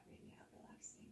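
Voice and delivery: quick breathy whisper on the exhale; whispered guidance during the release; whispered cue. Shown as a demonstration. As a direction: let the words ride out on the breath.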